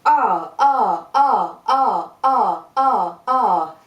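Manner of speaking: loud punchy R bursts from the diaphragm; short shouted vowel pulses; kicked R sounds. Shown as a demonstration. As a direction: kick each R out hard.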